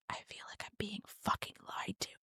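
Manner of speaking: hushed, secretive whisper. Retold or imitated. Confiding in Ben in the middle of the investigation, whispered. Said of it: "I whispered to him".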